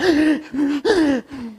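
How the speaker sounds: high, shuddering sob-gasps; imitated sobbing gasps. Pastor mimicking his weeping child, high gasping sobs. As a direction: mimic a child catching breath between sobs. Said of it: "melihat anak terseok-seok".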